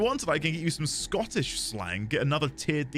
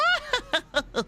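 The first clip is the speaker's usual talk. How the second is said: sudden high-pitched cackle; real squealing laugh; high burst of laughter. Real, not acted.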